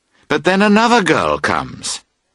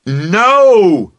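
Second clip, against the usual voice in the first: high, loud exasperated cry; shout; 8 semitones higher, 5 dB louder than usual. A narrator's reading. Boat owner shouting at Emily, loud and high. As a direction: shout it out in frustration. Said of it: "you shout".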